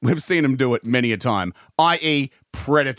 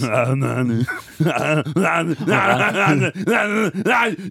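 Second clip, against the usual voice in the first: hammed-up cackling run of laughter; imitated Arnie laugh; 2 semitones higher than usual. Podcaster imagining Arnie doing a kid's laugh, hammed-up laughing. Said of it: "imagine Arnie doing that now".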